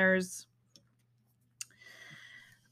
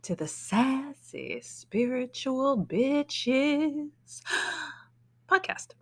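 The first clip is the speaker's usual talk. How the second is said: drawn-out show intro; 3 semitones higher, much slower than usual. Acted. Podcast intro, drawn-out show name.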